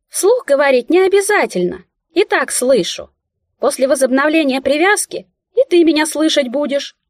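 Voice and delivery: a little mouse explaining patiently. A narrator's reading. Mouse explaining telepathy to heroine: high squeaky voice. Mouse answers unspoken thought.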